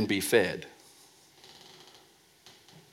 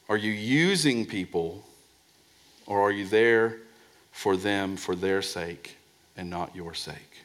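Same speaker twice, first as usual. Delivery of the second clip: ordinary delivery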